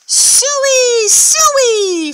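loud, high hog call; calling the pigs. Acted. Farmer calling his pigs, high loud hog call. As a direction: call Suey across the yard. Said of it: "Farmer called".

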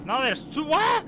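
loud, high, rising to a squeal; alarmed disbelief. Real, not acted. Player spotting a second enemy, alarmed shriek. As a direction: blurt it out, peaking in a shriek.

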